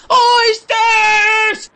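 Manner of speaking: high, loud shout; a hungry boy shouting for oysters; crying out. Acted. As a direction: shout the word out, desperate. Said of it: "I cried".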